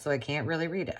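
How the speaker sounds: ordinary talk, nothing marked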